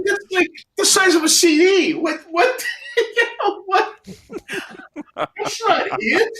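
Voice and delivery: high-pitched laughing protest; laughing disbelief. Retold or imitated. Telling Bert the sketch is only CD-sized, laughing. His stunned reply, laughing.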